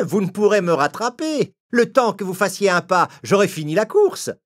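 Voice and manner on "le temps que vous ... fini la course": normal talking voice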